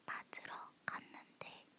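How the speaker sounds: ordinary talk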